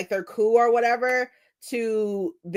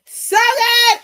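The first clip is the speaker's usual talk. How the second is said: high, loud gush; high excited gush; 14 semitones higher, 9 dB louder than usual. Real, not acted. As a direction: burst out with delight. Gushing about the book.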